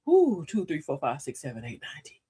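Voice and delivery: calming count. Shown as a demonstration. Showing viewers a calming count, fading out. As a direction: let each number fade away on the breath. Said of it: "take a couple breaths and count".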